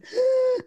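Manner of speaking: sharp high gasp for air, acted out; winded gasp. Retelling the fall, gasping for breath. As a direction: show being winded with a big gasp. Winded after hitting the bump.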